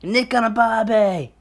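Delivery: high, drawn-out fake Aussie holler; mock Australian accent. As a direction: stretch it out in a terrible Australian accent. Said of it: "that's how Australians talk".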